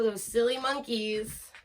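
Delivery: ordinary delivery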